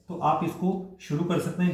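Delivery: usual speaking voice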